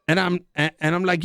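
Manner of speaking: usual speaking voice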